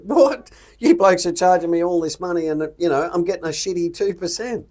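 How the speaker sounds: high, loud mock outrage at the fees; outraged disbelief. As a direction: imitate a shocked investor yelling "What?". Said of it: "we'd all go".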